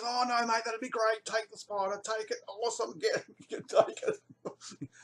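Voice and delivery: high, keen urging; relieved bloke, half laughing; eager tip attendant. Retold or imitated. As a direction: push the spider on him, glad to be rid of it. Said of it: "He goes".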